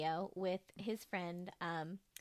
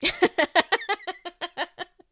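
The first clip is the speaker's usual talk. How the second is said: loud laughing burst; 8 semitones higher, 12 dB louder than usual. Real, not acted. Laughing at cohost's slip.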